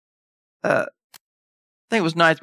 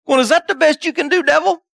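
mock taunt; 6 semitones higher than usual. God taunting the devil.

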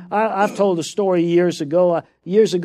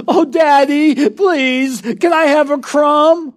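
put-on slow, crying plea of a child; mock whiny begging; 8 semitones higher, 5 dB louder, slower than usual. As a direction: whimper for a crumb like a pitiful kid. Son begging father, mocked.

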